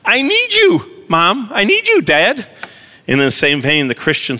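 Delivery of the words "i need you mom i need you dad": put-on much higher pleading kid voice, quick at the start; high childlike plea, stretching out I need you; little child voice. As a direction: whine like a small child begging Mom and Dad. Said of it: "A little child says".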